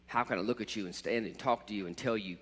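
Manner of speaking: usual speaking voice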